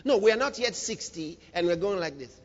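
ordinary talk

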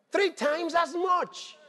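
builds to a full shout, high and loud; slow at first, then crying it out to the church; preaching shout. Real, not acted.

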